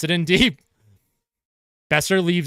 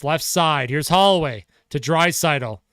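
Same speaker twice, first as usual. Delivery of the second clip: normal talking voice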